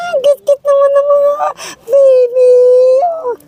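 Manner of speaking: high squealing over cute babies; high cooing gush. Real, not acted. Gushing over baby goats.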